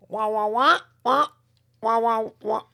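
nasal muted-trombone wah wah; droning nasal wah-wah like a muted horn; Charlie Brown's teacher. Retold or imitated. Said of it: "You do that very well".

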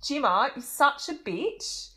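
high, loud, drawn-out mock-Australian twang; Australian accent attempt. Shown as a demonstration. Showing co-host a remembered TV catfight line, exaggerated Australian accent. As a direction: stretch it out like an Australian reality-show contestant. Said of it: "I can only say three things".

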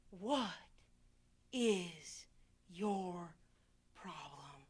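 slow, low whisper of disbelief. Real, not acted. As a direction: let it drop to a breath. Incredulous whispered rebuke.

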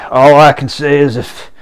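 tipsy neighbor's threat. Retold or imitated. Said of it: "the drunk guy's like".

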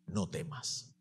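soft hushed quote. Acted. The risen Jesus speaking gently, hushed and low. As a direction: drop to a low hush for "No temas". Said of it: "Y su primera frase tras resucitar fue".

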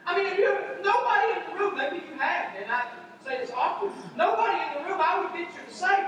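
ordinary talk

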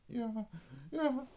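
high, squeaky eager yeses; freed prisoners answer all at once, high and eager; chorus agreeing. Acted. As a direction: everyone chimes in high. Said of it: "Alle sagen so".

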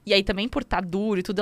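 usual speaking voice

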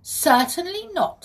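high, emphatic start, firmly refusing; firm refusal. A narrator's reading. Peter refuses to free the pixie, firm.

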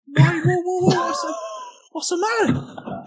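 high squeaky alarmed whoa; husband on the phone, high mock alarm. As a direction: play it up as startled and high.

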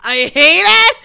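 loud, high wail; exasperated outburst. Real, not acted. Co-host dreading the clip getting stitched, exasperated wail. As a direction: cry it out at full pitch, half laughing.

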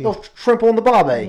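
high, loud hammed-up Aussie; bad Australian accent. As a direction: play a cartoon Australian. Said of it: "it's my terrible Australian accent".

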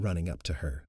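plain reading voice

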